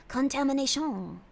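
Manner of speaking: drawn-out mock French accent; French-accented, stretched last syllable. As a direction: say it slowly as if in French. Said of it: "saying that with a French accent".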